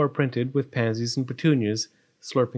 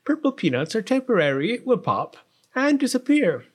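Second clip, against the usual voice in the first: high, singsong practical parent; doting storybook mother; consoling mother; 9 semitones higher than usual. A narrator's reading. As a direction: reassure her in a high voice. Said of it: "said her pin-headed but practical parent".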